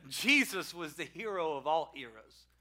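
ordinary delivery

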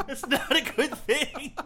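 high and loud, laughing all through; laughing rant. Real, not acted. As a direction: rant while cracking up. Laughing rant about the scene.

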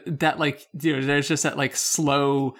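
normal talking voice

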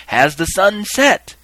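drawn-out, high, loud question; crying out. Acted. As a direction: stretch the call out to the crowd. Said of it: "He would cry, cry out again".

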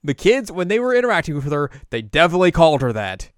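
high, loud panicked babble; frantic pleading. Retold or imitated. Apprentice pleads with Inuyasha, high loud panic. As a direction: beg frantically not to be thrown back. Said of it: "He's like".

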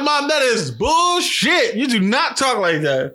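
high, drawn-out incredulous protest; exaggerated retold indignation; mock outrage. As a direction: call out mom with slow, punched-out disbelief. Acts out calling mom's bluff.

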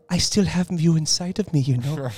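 lightly voiced Rossellini line, half joking; Isabella Rossellini impression. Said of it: "Isabella Rossellini line".

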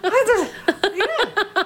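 high, giggly stammer; flustered laughing fan. Retold or imitated. Starstruck fan meeting her idol, giggling. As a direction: giggle through the nerves. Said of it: "I'm like".

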